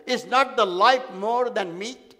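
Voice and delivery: slow, stressing each word; slow emphatic quote. Acted. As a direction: draw the verse out for weight. Preacher quoting verse slowly.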